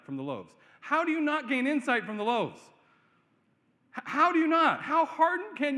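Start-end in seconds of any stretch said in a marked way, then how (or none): 0.8-2.6 raised, higher voice demanding an answer; incredulous preacher's challenge; exasperated preaching. Real, not acted. Preacher's exasperated rhetorical question. 4.0-4.8 much louder and higher, nearly yelling the repeat; near-shouted disbelieving repeat; incredulous shout. Real, not acted. Preacher repeats question, much louder.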